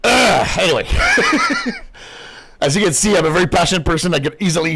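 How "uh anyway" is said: laughs, breaks off, laughs again; burst of laughter. Real, not acted. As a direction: laugh it off after the rant. Laughing after rant.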